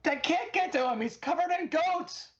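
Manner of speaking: frantic yell to a distant ally; yelling across. Acted. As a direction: call out over the chaos to Spangle. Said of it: "I yell back at".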